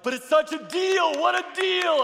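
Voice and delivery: high, loud and thrilled about the deal; eager bargain-hunter plea. Retold or imitated. Husband pleading with his wife over a bargain, giddy and high.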